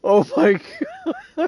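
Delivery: shrieking high laughter; can't breathe from laughing; laughing hysterically. Real, not acted. Gamer cracking up at the game, howling with laughter.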